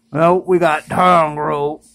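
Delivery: normal talking voice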